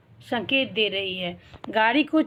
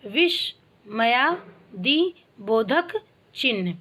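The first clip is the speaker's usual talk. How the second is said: very slow, broken into parts for learners; slow teaching demo with pauses between parts; syllable-by-syllable reading; much slower than usual. Shown as a demonstration. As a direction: sound out विस्मयादि बोधक चिन्ह piece by piece. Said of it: "ऐसे पढ़िएगा".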